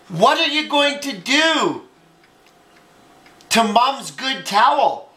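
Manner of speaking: scandalized storybook exclamation; shocked question. Acted. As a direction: ask it aghast, stretching the words. Gasps, sister alarmed about the towel.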